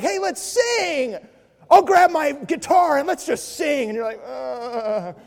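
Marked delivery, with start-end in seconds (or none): 0.0-3.9 high, slow, sing-song mock cheer; hammed-up happy-clappy friend. 4.3-5.2 mock groan. Mocking groan of suffering believer.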